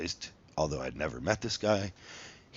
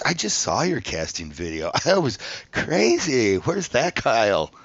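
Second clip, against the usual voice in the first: laughing, higher and louder, slowed; excited, laughing; 6 semitones higher, 7 dB louder than usual. Retold or imitated. Producer to Kyle, gushing with a laugh. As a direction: gush about the wild casting tape.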